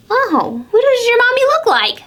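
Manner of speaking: high quick squirrel voice. Acted. Squirrel asking the owlet about its mother, high and eager. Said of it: "said Squirrel".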